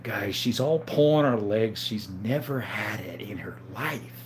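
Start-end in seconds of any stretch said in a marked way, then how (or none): 1.8-4.2 whispered secret to the viewers; stage whisper. Pretend.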